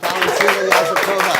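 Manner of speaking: loud host introduction; announcing name. Real, not acted. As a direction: call out the guest's name.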